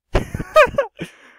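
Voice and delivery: shrieking burst of laughter; high loud laugh. Real, not acted. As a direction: crack up hard at the clip. Reacting to the roast video.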